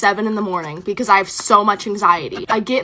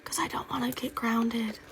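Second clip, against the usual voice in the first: much softer, voiceless whisper; whispered admission; 14 dB quieter than usual. Real, not acted. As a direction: drop to a small whisper, confiding the worry. Dreading punishment, trailing into whisper.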